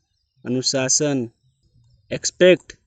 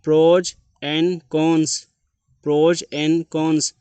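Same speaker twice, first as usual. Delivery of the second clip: "Pros and cons" stretched out very slowly, every sound clear; slow clear drill; 2 semitones higher, much slower than usual. Shown as a demonstration. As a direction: spell each sound out for the learner, very slowly. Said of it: "teacher drills word pronunciation". (बहुत धीरे, साफ़ उच्चारण के साथ)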